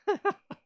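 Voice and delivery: short bright giggle, loud; quick giggle; high laugh. Real, not acted. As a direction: burst of real laughter. Laugh after her whine.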